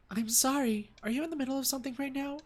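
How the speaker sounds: put-on high, quick young woman voice; girlfriend voice. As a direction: sound apologetic and girlish. Rumi voiced on phone.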